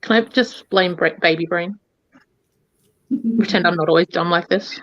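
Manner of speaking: ordinary delivery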